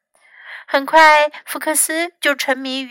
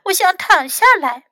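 high little-one voice; small child's voice; 8 semitones higher, 3 dB louder than usual. A narrator's reading. Hurt Louis asks Fox to help, high small voice.

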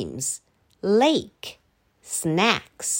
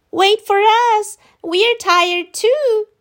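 high, loud calling, slowing on the second line; children calling after the group; shouted; 11 semitones higher, 5 dB louder, slower than usual. Acted. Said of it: "shouted the children".

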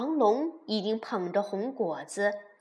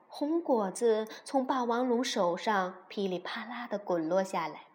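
usual speaking voice

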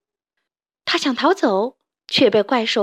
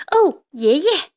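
higher, louder and drawn-out cry of relief; little toad calls out in wonder; excited cry; 5 semitones higher, 4 dB louder, slower than usual. Acted. Little toad greets brave grandpa, slow excited cry. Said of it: "他叫道".

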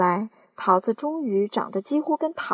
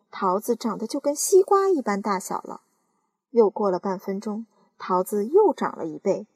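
ordinary delivery

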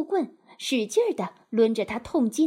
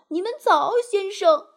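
high-pitched, louder polite greeting; extremely polite; 8 semitones higher, 5 dB louder than usual. A narrator's reading. Disguised washerwoman greets the sentries, very politely. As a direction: greet them brightly with exaggerated courtesy. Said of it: "我特别彬彬有礼地说".

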